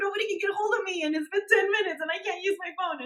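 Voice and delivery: high, frantic mock panic, laughing through it. Friend retelling her dead-phone panic, high mock panic. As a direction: play up the phone-free meltdown. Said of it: "and you're like".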